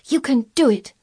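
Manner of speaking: high, intent urging; to herself. A narrator's reading. Mary willing Colin to dig, urgent. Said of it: "said Mary to herself".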